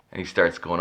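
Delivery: ordinary delivery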